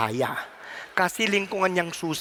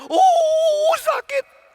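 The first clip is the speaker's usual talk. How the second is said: high, loud drawn-out "ooh" of pain; hammed-up ouch, pitched way up; mock cry of pain; 7 dB louder, much slower than usual. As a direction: wail it out as if scorched. Acts someone burned by fire.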